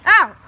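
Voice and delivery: sharp loud ow; pained yelp. Real, not acted. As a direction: yelp in sudden pain. Sudden pain exclamation.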